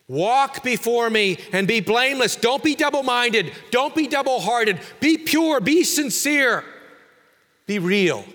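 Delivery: preaching at full force. Shown as a demonstration. Preacher pressing God's call on the congregation, at full force. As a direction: thunder God's call to wholeness. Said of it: "what God is saying to Abram".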